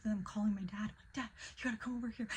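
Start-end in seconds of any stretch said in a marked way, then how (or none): 1.1-2.3 very fast, higher excited plea; breathless-paced, pitched-up urgency; urgent retold call. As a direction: rush it out to summon Dad.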